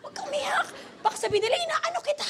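very high comic panic; mock-frantic plea to stop crying; high-pitched, embarrassed urging; flustered retelling. Retold panic at disciple's crying.

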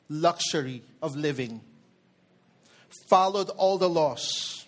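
usual speaking voice

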